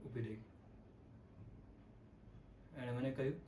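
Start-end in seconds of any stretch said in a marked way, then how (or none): 0.0-0.4 soft, low dramatic repeat; hushed echo. Acted. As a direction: let it sink, much quieter and lower. Line echoed softly after long pause. (ધીમા, નીચા અવાજે)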